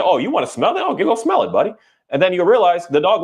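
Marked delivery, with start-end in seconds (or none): 0.0-1.7 high, sweet voice to a dog on a walk; cooing to the dog. Retold or imitated. Owner encouraging the dog to go sniff, cooing high. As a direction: coax the dog like a buddy, pitched way up. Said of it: "You just say".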